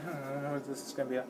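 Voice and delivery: very soft, fast mumble to himself; muttered musing. Retold or imitated. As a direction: think aloud under your breath. Retelling his own waiting thoughts.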